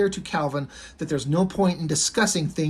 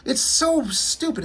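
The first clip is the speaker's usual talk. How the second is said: put-on kid's disgust; 6 semitones higher, slower than usual. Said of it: "as a kid, I'm like".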